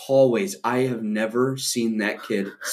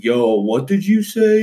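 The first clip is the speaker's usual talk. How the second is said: louder, slower, higher tough-guy challenge; big brother confronting; 6 semitones higher, 5 dB louder, slower than usual. Retold or imitated. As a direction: step up like a protective big brother. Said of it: "playing all Big Brother".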